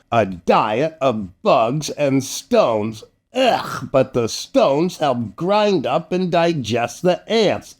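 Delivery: ordinary delivery, nothing marked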